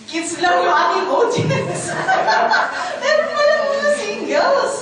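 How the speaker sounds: high, laughing Taglish aside; laughing surprise. Real, not acted. Speaker laughs, surprised the audience has singles, not only kids.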